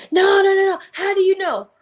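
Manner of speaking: high, loud repeated nos; mother defending her son, shouting; angry denial. Retold or imitated. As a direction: protest loudly against the accusation. Woman protesting her son's innocence.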